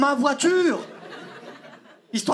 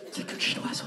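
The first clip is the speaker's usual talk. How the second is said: whisper; 11 dB quieter than usual. Retold or imitated. Calming himself before possessed girl.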